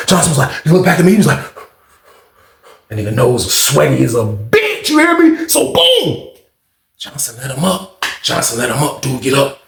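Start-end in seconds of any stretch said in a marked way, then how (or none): none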